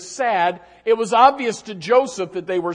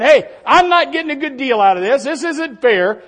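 raised, louder mock complaint; mock griping; 5 semitones higher, 6 dB louder than usual. Preacher voicing the complaint Joseph never made, griping. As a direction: protest like a griper, loud then easing. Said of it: "Joseph wasn't whining. Joseph wasn't griping".

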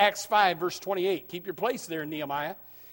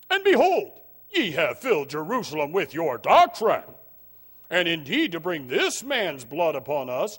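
high priest voice; 5 dB louder than usual. Acted. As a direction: lightly play the accusing high priest. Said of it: "That's how I think he sounds".

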